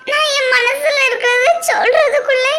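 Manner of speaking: speaking through tears; tearful. Acted. Bride tells her friend about the forced marriage, tearful. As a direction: let the tears break the words.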